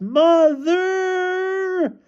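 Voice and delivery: very high, drawn-out cry for mother; long shrieked call of a hurt child; child's wail. A narrator's reading. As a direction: wail for mom at the top of the range. Child yells for mother after crash.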